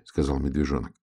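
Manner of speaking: ordinary delivery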